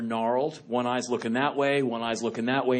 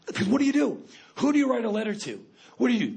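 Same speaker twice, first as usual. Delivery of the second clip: exasperated rant; 10 semitones higher, faster than usual. Real, not acted. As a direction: hurl the questions out in frustration. Ranting about the war.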